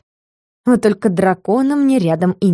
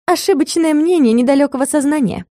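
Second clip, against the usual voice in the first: smirking; 7 semitones higher than usual. A narrator's reading. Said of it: "усмехнулась я".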